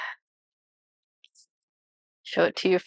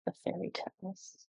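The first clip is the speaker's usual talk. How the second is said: much softer, murmured echo of the card name; hushed repeat; 17 dB quieter than usual. Real, not acted. Reader repeating the card title to herself, hushed. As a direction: say it again under your breath, half to yourself.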